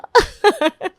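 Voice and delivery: high, sly scheming laugh; a sister-in-law laughing over her next plan. Acted. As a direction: laugh like you're plotting. Plotting jethani after threat.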